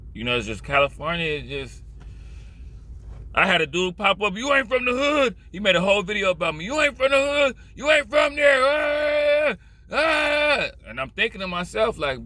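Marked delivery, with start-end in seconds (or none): 4.4-5.4 high mock callout; high, taunting imitation of a heckler; mocking accuser. Mimics dude who accused him online. 6.6-10.7 high-pitched mock yelling; high mock shout running into drawn-out yells; mocking accuser. Mimics dude who accused him online.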